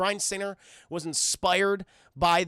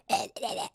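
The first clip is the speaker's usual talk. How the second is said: soft voiceless garbled choking; imitated gurgling; 4 dB quieter than usual. Wounded warden answers the turret, mock gurgling. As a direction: gargle nonsense as if shot in the throat.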